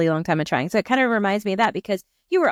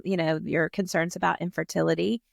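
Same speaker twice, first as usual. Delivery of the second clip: ordinary talk, nothing marked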